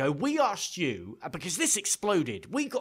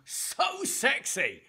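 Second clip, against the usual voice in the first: mock scream; 8 semitones higher, much slower than usual. Gamer gloating over the goal replay, mock scream. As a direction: stretch it out in a high mock scream. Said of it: "where I scream".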